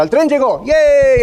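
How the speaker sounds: playful, drawn-out hooray; mock cheering. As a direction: pretend to cheer the news. Mock cheer over train's arrival.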